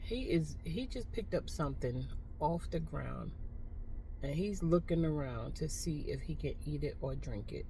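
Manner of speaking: low, hushed play-by-play; hushed commentary. Real, not acted. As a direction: keep it down while watching him. Quiet running commentary on a stranger.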